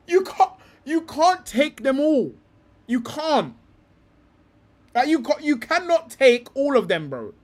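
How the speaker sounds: high, loud and slow, pleading in disbelief; near-crying frustration, dragging out the words; as if begging someone to finally get it; exasperated outburst. Real, not acted. Exasperated rant about squad balance.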